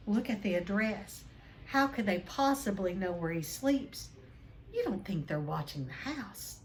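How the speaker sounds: plain reading voice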